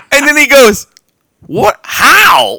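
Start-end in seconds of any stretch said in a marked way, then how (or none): 1.5-2.6 high, loud stunned disbelief; shocked disbelief. Retold or imitated. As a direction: blurt it out, stunned. Retold brother's shock.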